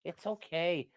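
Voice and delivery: high, soft, slow reassurance; tender, high pet voice; soothing the dog. Real, not acted. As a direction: hush a panicking pet.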